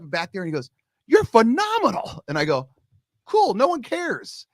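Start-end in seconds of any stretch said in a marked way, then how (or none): 1.1-2.2 high, slow, drawn-out praise; impressed coach. Retold or imitated. Uncle coach praising his nephew's snap, high slow. 3.3-4.5 high, slow, deadpan shrug; teen indifference. Retold or imitated. Teen brushing off the coach's praise, slow and offhand.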